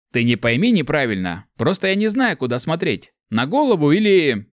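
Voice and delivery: high loud mock-innocent voice; teasing. A narrator's reading. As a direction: needle it, playing dumb. Воин дразнит тварь. (дразнящий тон)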